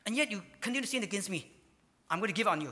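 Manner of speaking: hammed-up exasperated rebuke; exasperated scolding. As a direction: scold like you've warned them a hundred times. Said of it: "He said".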